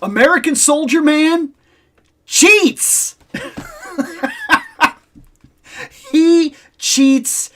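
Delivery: high, indignant accusation, playfully overblown; mock outrage. Real, not acted. Gamer telling a story about Guile, mock outrage.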